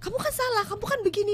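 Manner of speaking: accusing scold. Retold or imitated. Scolding a partner straight after a mistake, high accusing voice. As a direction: snap at them that they're wrong. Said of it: "ditegur langsung begitu ya".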